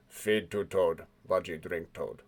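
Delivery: low, slow broken creature speech. Acted. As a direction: drag it out, low and dim. Haji Baji voice.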